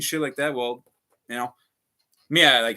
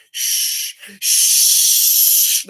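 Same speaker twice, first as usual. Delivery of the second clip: sharp hissed shh, aimed at him; imitated shushing; 5 semitones higher, 7 dB louder than usual. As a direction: hiss a rude shush like she did. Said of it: "She's like".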